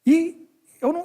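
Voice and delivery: ordinary talk, nothing marked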